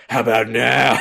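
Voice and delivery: loud, put-on punchline voice; hammed-up monster reveal, belted out; mock menacing reveal. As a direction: burst out loudly in a mock threat. Mock villain reveal in imagined exchange.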